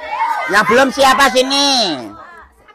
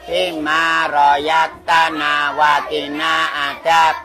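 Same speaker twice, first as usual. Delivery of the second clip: prayer; 6 semitones lower, 4 dB quieter, much slower than usual. Acted. Leads meal prayer.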